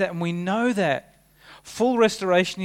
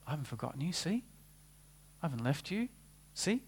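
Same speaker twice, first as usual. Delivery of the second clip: much softer, tender and reassuring; gentle reassurance; 10 dB quieter, faster than usual. Acted. Preacher voicing Jesus reassuring Thomas, soft and tender. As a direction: softly show him the wounds.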